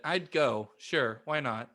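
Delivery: usual speaking voice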